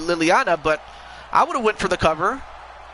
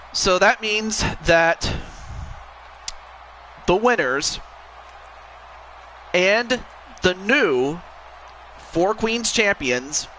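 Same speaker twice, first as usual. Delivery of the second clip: slow, formal ring announcer; wrestling commentator; much slower than usual. Acted. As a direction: draw out the big announcement. Announces the new champions.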